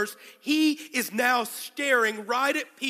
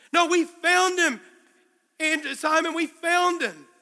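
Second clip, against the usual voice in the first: loud, high, slower and pressing; urgent excited plea; 5 semitones higher, 4 dB louder, slower than usual. Acted. Andrew pressing his brother Simon to hear the news, urgent excited plea. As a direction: make him finally understand.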